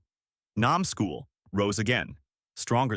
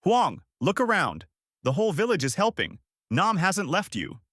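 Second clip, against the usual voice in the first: high-pitched rallying call; restrained, encouraging yell; mild yell; 7 semitones higher than usual. A narrator's reading. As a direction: urge them on insistently, pitch raised.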